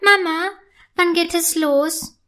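high-pitched, eager asking; excited child's question. A narrator's reading. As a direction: ask excitedly, bursting with anticipation. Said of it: "fragt sie aufgeregt".

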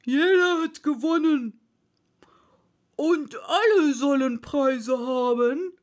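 loud, grand Dodo announcement; Dodo's verdict. A narrator's reading. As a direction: proclaim it weightily to the whole crowd. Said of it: "Endlich sprach der Dodo".

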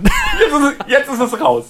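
real laughter, pitched well up; high, laughing confession. Real, not acted. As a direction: blurt it out through laughter. Laughing after an embarrassing confession.

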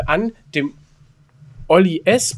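normal talking voice

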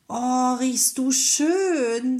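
high, slow, wondering mouse; a tiny mouse marvelling at the candle's scent, drawn out; little mouse voice. A narrator's reading. Mouse sniffing the candle, slow and high. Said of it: "sagte das Mäuschen".

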